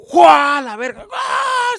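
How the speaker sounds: loud, high mock shriek; terrified scream. Rider hit by the surprise drop, screaming. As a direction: scream as the floor drops away.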